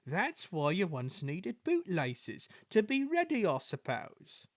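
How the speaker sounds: slow, mock-innocent tease; a sly dig dressed up as innocence. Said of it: "said Toby innocently".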